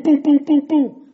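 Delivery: loud, high "boom" knocks; imitated knocking. Mimicking a solicitor pounding the door, loud booms. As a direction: bang out each boom like a fist on the door.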